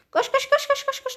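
being chased. Real, not acted. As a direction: panic rising, running for life. Fleeing in Among Us.

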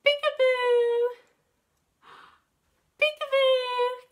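high, loud surprise squeal; playful peekaboo. Pretend. Peekaboo game with toddlers.

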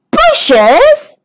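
high, surprised question; exclaimed. Acted. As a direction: zebras unable to believe what they just heard. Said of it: "exclaimed the zebras".

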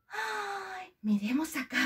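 ordinary delivery

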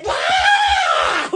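high-pitched yelled cheer; giddy, high shout; excited whoop. Real, not acted. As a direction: whoop it high through laughter. Whooping while laughing at effects.

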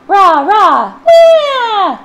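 very loud, high rah rah wah; loud family noise. Acted. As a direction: wail the wah like a crying baby. Loud family, baby wailing.

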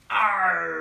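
loud pirate arr with a funny face; silly pirate cry for kids. Shown as a demonstration. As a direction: growl it pulling a funny face. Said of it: "put a funny face as well".

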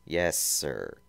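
plain reading voice

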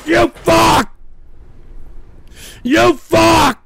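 loud, tearful curse; furious outburst at the trolls; angry shout. Real, not acted. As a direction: shout it in two hard words. Cursing at trolls ruining show.

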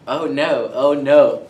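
loud mock dismay; setting up a catchphrase. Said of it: "Do the oh yeah".

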